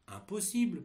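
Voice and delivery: usual speaking voice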